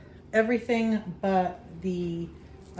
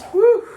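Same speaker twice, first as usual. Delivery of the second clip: relieved whew; 13 dB louder than usual. Real, not acted. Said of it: "dry my tears".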